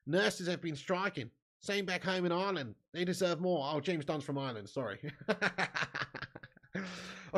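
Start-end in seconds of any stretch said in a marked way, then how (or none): 5.2-7.4 slow, laughing through the words. Real, not acted. Streamer laughing after misreading a viewer's name, amused. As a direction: giggle at your own mix-up. Laughing at his own mistake.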